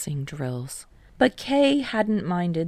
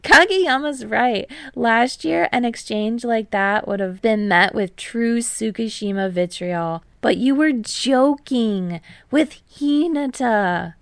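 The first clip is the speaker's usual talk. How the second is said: higher, brighter friend voice, lightly voiced; Tadashi's voice; 4 semitones higher, 4 dB louder than usual. A narrator's reading. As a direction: read Tadashi a bit higher and louder.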